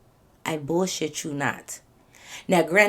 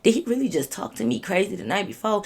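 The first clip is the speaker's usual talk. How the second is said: ordinary delivery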